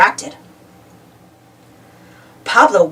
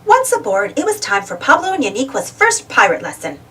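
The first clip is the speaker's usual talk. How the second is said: ordinary delivery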